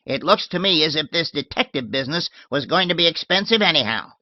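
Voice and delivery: put-on old lady shooing her maid off, tart and loud; shrill old lady. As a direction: bark it like a cranky old lady. Miss Letitia voiced.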